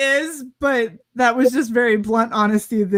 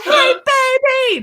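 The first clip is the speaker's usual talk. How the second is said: mock catcaller; faster than usual. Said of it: "It was like".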